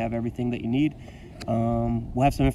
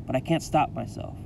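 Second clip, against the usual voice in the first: plain reading voice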